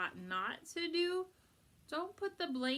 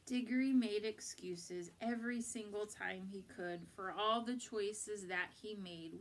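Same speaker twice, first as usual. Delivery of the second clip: ordinary delivery, nothing marked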